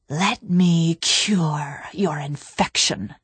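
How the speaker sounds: very slow, whispery monstrous sneer; sneering Gravemind. A narrator's reading. The Gravemind to struggling Cortana, slow sneer. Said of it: "he sneered".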